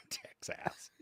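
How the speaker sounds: ordinary delivery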